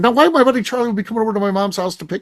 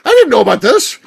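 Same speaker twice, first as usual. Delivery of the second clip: high, louder, shocked question; hearing the house was sold without warning; shocked disbelief; 12 semitones higher, 6 dB louder than usual. Retold or imitated. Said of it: "I'm like".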